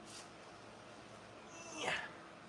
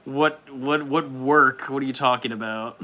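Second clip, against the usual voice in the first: ordinary talk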